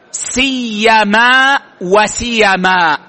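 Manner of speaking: سيما وسيما spelled out slowly, each word drawn out; slow careful recitation. Shown as a demonstration. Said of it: "يجوز أن يقال". (نطق بطيء متأنٍ)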